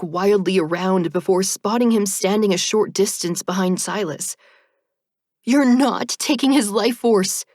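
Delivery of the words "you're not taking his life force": loud defiant shout. A narrator's reading. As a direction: shout it at the reaper. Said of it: "I shout".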